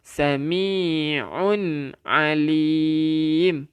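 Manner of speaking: Sami'un alim stretched very slowly, nun clear; very slow demo of Sami'un alim. Shown as a demonstration. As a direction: drag out Sami'un alim with a falling end. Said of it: "dia tak jadi sami'u".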